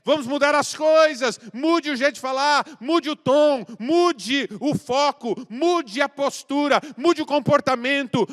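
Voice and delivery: loud, high, rising sermon call; full force preaching. Acted. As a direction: preach it out to the whole church.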